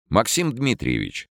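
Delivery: Максим Дмитриевич called across the hall; calling out. Acted. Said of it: "спросил полушутя".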